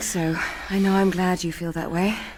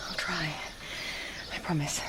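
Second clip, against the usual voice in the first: quiet; 3 semitones lower, 11 dB quieter than usual. Acted. Quiet, hesitant parting promise.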